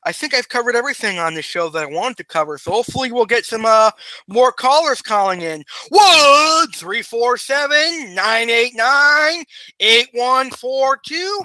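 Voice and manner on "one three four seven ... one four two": slow, higher and louder, each digit spaced; slow, emphatic number. Acted. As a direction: spell out every digit slowly and loudly. Urging callers to call in.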